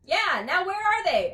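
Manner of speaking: high, loud, drawn-out question; loud exasperated mother; urgent demand. A narrator's reading. Dee Dee demands where babies are.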